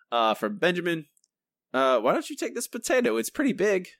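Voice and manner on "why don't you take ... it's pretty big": high, fast imitation of Marge; a light Marge Simpson impression. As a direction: lift the voice like a fussing mom. Reading Marge quote aloud.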